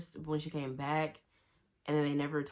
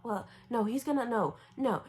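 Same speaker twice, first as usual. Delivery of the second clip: higher, stammered mock protest; flustered; 8 semitones higher than usual. Katniss cornered by Gale's question, flustered. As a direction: act out a flustered denial, pitched up. Acting out flustered character in argument.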